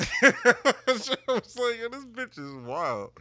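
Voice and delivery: high, loud, words through laughter; laughing. Real, not acted. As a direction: crack up while telling it. Cracks up retelling the date.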